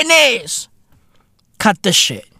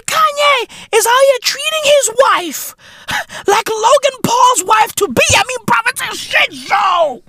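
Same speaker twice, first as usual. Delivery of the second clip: rowdy jeering pub banter; 11 semitones higher than usual. Real, not acted. High rowdy rant.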